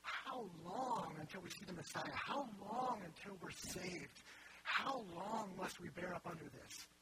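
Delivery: slow, drawn-out prophetic lament; crying out. Acted. Preacher voicing Old Testament prophets to God, crying out. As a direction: stretch each plea out slowly, acted. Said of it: "they're all crying out".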